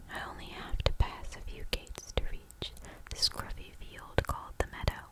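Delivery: usual speaking voice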